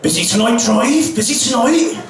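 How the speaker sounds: lightly voiced Bristol drunk, rising; imitating a mate's Bristol burr; Bristol accent. Drunk mate asks the taxi driver, in a light Bristol accent. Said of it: "as my mate from Bristol says".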